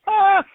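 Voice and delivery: high, loud "ah" of mock shock; mock outcry. Balloon guy replaying his reaction to the five-thousand-dollar price, mock shock. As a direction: yelp it high, hamming up the shock.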